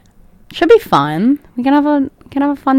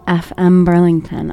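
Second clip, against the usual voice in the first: normal talking voice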